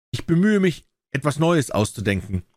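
plain reading voice

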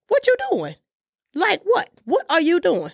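high-pitched exasperated questions; incredulous scolding. Real, not acted. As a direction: demand an answer in high-pitched disbelief. Incredulous scolding about eating out.

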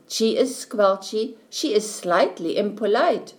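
ordinary delivery, nothing marked

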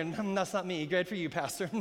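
low, softened mock listener reply; quiet dismissive listener. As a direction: murmur it like an unconvinced churchgoer. Voices a smug listener's reply.

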